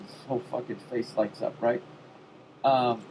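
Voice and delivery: normal talking voice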